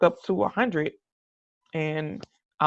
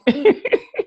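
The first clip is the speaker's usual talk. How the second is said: bright laugh; 9 semitones higher, 4 dB louder than usual. Real, not acted. Laughs at own generosity.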